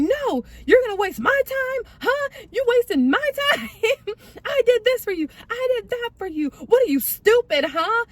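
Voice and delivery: high, loud mock-angry berating; imitated going off. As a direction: act out him losing it and hurling accusations. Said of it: "He was going off".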